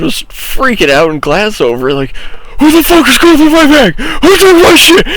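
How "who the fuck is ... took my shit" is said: high, loud, slowed-down mock yell; retold screaming. Classmate yelling across class about his stolen stuff, high loud mock yell. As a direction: act out a panicked classmate yelling. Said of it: "they're screaming in class".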